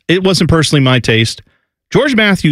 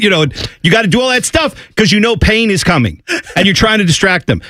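ordinary talk

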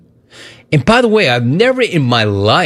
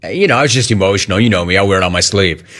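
low, quick, offhand imitation; mock excuse; 6 semitones lower, faster than usual. Host mimicking the quarterback's excuse, mock-sheepish and low. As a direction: mimic a lame excuse, pitch dropped and hurried. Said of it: "And then you say".

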